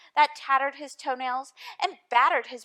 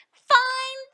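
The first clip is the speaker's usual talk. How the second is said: high, loud sneering jab; mocking eagle's parting sneer; 11 semitones higher, 7 dB louder than usual. A narrator's reading. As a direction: sneer it at the elephant. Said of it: "sneered the bird".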